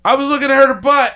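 high blurted admission; mock confession. Confessing a sin mockingly.

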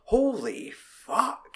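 high, drawn-out stunned gasp of a curse; shocked exclamation. Retold or imitated. Reliving the brutal film scene for his friend, shocked and slow. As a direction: let the shock stretch out slowly. Recalling the arm-snapping scene.

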